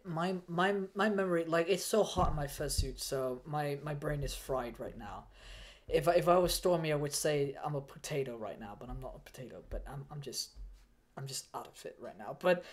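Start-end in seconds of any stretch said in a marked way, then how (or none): none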